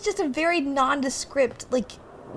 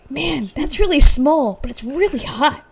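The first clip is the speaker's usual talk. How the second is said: louder and higher, slowed on really small; mock amazed exclaim; 4 semitones higher, 7 dB louder than usual. Friend mimicking a movie character staring at a tiny woman, mock amazed. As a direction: ham up the awe, then a leering aside. Acting out his own reaction.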